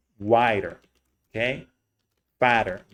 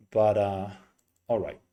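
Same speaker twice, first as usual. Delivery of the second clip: normal talking voice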